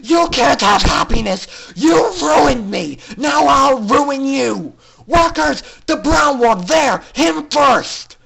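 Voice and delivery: villain orders her workers to seize the colt; furious yelling. Acted. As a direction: yell orders at the workers in fury. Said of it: "yelled with more fury".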